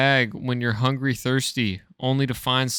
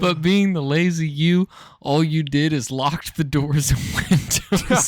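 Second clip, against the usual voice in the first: giggling; 3 semitones higher than usual. Real, not acted. Still giggling while reading.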